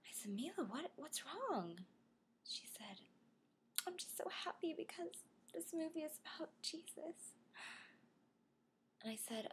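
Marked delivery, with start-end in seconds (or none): 0.3-1.8 softer, higher, concerned question; soft, high motherly concern. Retold or imitated. Mother consoling her teary daughter. 3.9-7.3 high, soft, mock-tearful little girl; squeaky, hushed fake-crying voice; fake teary. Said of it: "like, fake teary".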